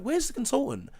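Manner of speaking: high, pressing retold demand; imitating a high frustrated demand; exasperated demand. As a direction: push them for an answer, voice up high. Demanding answers from nurses.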